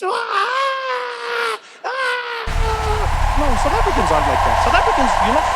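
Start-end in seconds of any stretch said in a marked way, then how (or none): 0.0-3.4 very high starstruck shriek; hammed-up fan wail; mock fainting scream. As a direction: scream yourself into a swoon. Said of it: "And then they faint".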